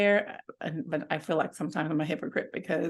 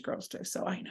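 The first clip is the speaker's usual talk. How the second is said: whispering; 9 dB quieter than usual. Real, not acted. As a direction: whisper it under your breath. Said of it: "I have to whisper 'cause my daughter may be somewhere".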